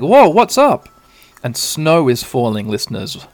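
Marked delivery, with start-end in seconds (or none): 0.0-0.9 higher, louder surprised whoa; startled Tai. Acted. Tai reacting to Sora, loud and startled. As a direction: caught off guard, calls out. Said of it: "And Tai's like".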